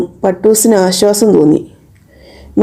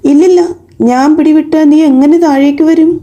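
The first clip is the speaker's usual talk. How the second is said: higher-pitched anxious protest; worried refusal; 6 semitones higher than usual. A narrator's reading. Sister refusing to let go of her brother's string, worried. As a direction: refuse quickly in a raised pitch.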